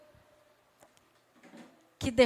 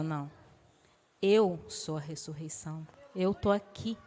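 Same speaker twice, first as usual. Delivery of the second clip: preacher lowers his voice for Jesus' reply; Jesus speaking gently; 9 semitones lower, 9 dB quieter than usual. Acted. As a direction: lower and much softer, a calm deep Jesus.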